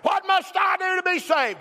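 high, drawn-out imitated cry. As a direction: call out as the desperate jailer, pitched well up. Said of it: "he cries out and says".

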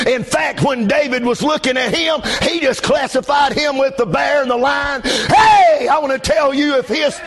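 high, shouted pulpit drive; full-force preaching. Real, not acted. Pastor urging church to quit calling the devil champion, shouted preaching. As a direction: drive each point home in a preacher's shout.

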